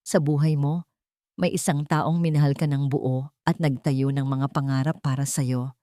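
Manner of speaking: plain reading voice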